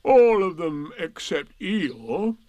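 slow gloomy donkey voice; Eeyore voice. Acted. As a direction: drag it out, gloomy and glum.

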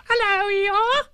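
very high squeak; squeaky Roo. Acted. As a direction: squeak it out, tiny and eager. Roo's squeaky voice.

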